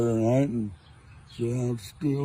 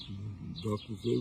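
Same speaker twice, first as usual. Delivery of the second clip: usual speaking voice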